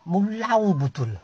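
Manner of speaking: slow, drawn-out muttered complaint; slow resigned grumble. Acted. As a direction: drag the words out in disgust. Grumbling at Yau.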